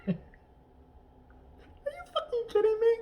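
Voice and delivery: high incredulous outburst. Real, not acted. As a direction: cry out in disbelief.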